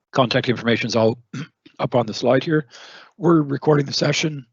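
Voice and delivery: hoarse, strained voice; hoarse voice, throat catching; losing voice. Real, not acted. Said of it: "I'm losing my voice already".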